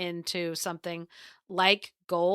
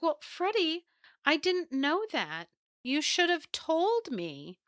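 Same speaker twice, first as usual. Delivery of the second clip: higher, whiny excuse; clueless wife; 8 semitones higher, 3 dB quieter than usual. Acted. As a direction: plead innocence in a higher voice. Wife's excuse in story.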